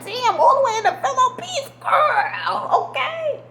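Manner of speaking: real overjoyed amazement; thrilled squeal. Real, not acted. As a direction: squeal it like you can't believe your luck. Thrilled at being followed abroad.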